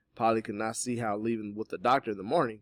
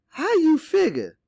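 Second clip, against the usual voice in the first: very high, drawn-out doubtful question; skeptical; 13 dB louder, slower than usual. A narrator's reading. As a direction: challenge it with slow, loud disbelief. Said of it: "skepticism in her voice".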